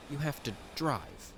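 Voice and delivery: ordinary delivery, nothing marked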